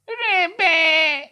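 very high squeaky cry; screaming hare. Acted. The scruffy hare screams for help in terror. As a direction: shriek like a frightened little hare. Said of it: "schrie der struppige Hase".

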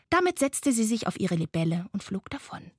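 ordinary delivery

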